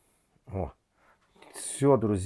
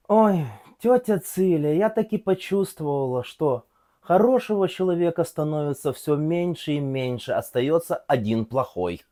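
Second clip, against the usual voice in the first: put-on Odessa accent; 9 semitones higher, 8 dB louder than usual. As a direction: sigh it out in a thick Odessa accent. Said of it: "Чисто одесский анекдот".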